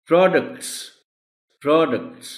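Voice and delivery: "Products" as PROD-ucts, high first syllable; stress on R. Shown as a demonstration. Teacher drilling stress, "products" as PROD-ucts. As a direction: punch the pr of "products", twice. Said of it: "آر پر stress ہے".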